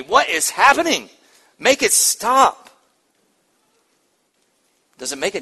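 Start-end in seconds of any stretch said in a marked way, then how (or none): 0.0-2.7 panicked plea. Retold or imitated. Imagined woman in labor pain.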